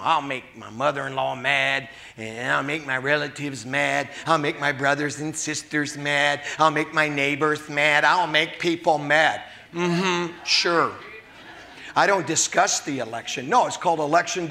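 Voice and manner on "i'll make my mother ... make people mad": timid excuse. Retold or imitated. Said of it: "you know".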